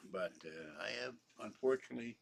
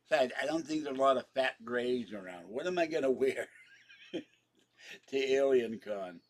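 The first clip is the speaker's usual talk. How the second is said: louder, laughing through the joke; 3 semitones higher, 8 dB louder than usual. Real, not acted. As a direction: giggle at your own joke, loud. Joking, laughing.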